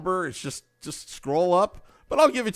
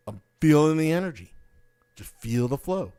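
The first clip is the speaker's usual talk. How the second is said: guru calm. Pretend. Guru calm after hype.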